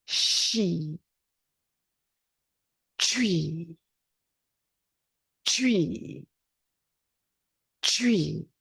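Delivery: long exhaled sounds ending in five repeated chuis; healing sounds, five chuis. Shown as a demonstration. Teacher leads the class through a final round of healing sounds. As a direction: breathe out ho hu tsu shi shi, then chui five times. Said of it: "five chuis when we get there".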